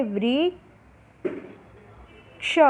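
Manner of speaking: वृक्ष held very long and soft; very slow soft reading. Shown as a demonstration. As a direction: draw वृक्ष out gently. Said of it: "और बोलना है".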